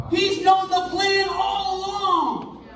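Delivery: high, slow, ringing shout; preaching shout. Real, not acted. Preacher crying out to the congregation, high and slow. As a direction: cry it out to the congregation.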